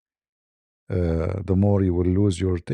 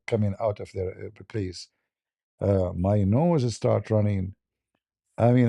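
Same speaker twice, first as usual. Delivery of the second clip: normal talking voice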